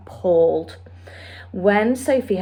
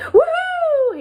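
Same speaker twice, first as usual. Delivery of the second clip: much higher, loud triumphant woohoo; joyful whoop; 7 dB louder than usual. A narrator's reading. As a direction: whoop with sudden delight. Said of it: "he cried at last".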